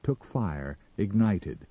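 plain reading voice

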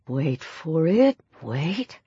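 low, soft, slow hush; tense hushed waiting. A narrator's reading. Peter tells himself to wait to pounce, hushed. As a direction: hold it back, hushed and drawn out. Inner thought while lying in ambush.

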